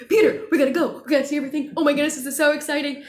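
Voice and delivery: gushing, acted-out enthusiasm; retold excitement. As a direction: re-enact a rush of travel excitement, urgent and loud. Retells her sudden urge to travel.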